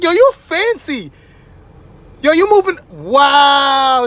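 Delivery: high, slow, amazed mock voice; lightly hammed-up wonder; impressed girl. Girl watches car move itself, high amazed gush. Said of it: "She was like".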